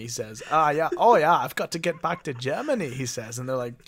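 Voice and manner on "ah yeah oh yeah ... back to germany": put-on higher-pitched comic German accent. Talking car longing for its homeland, in a German accent. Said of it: "You got a German accent and you can talk".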